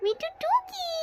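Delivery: very high, soft cooing peekaboo; playful peekaboo. Pretend. Playing peekaboo with a pet, tiny high voice. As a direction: coo it high and light, as to a baby.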